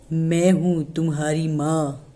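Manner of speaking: a wolf clumsily posing as mother; thick wolf voice. Acted. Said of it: "इसकी आवाज़ देखो कितनी मोटी है". (मोटी आवाज़ में माँ बनने का नाटक करता भेड़िया)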